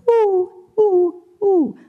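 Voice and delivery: high, loud hooting owl call. Shown as a demonstration. Voice coach showing students the owl, high owl hoot. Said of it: "there is the owl going".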